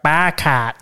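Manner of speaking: Boston "bar cart" with dropped R's; Boston accent. Shown as a demonstration. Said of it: "a thick Boston accent".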